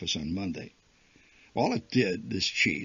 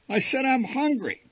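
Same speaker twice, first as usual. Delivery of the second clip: put-on high, louder, stubborn kid whine; insistent child; 5 dB louder than usual. A narrator's reading. Little brother repeating his demand, high and insistent.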